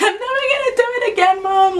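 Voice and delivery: high, fast, tearful pleading wail; retold bawling. Daughter begging her mom through tears, high and fast. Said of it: "I couldn't even talk because, you know, when you're crying".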